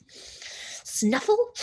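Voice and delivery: high snuffling pig noise; pig snuffle. Acted. Pig snuffling at the window, high and sniffy. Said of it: "said Mercy".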